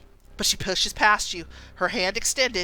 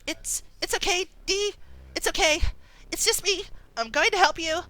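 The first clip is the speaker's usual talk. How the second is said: put-on high, soothing girl's voice; gentle young heroine soothing her pet; Imogen voiced; 12 semitones higher, faster than usual. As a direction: coax the scared horse gently. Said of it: "and she says".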